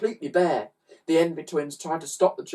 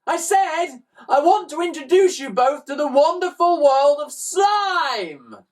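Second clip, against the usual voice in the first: high, loud shout up to the roof; shouting louder; 13 semitones higher, 8 dB louder than usual. A narrator's reading. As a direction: yell it so someone far off hears. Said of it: "Shout louder".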